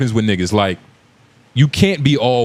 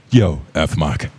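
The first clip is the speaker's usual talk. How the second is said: put-on British accent; 5 semitones lower, slower than usual. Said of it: "Jason Statham will say".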